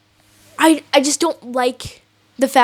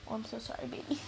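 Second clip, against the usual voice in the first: tender whisper; 25 dB quieter, faster than usual. Acted. Comforting pet in acted scene.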